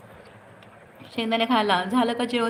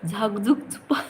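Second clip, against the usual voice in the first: laughing. Real, not acted. Reading an odd name aloud.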